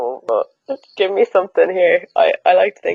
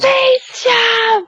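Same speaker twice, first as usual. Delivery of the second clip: sudden gasp of recognition; excited shout. Real, not acted. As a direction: shout it out, delighted.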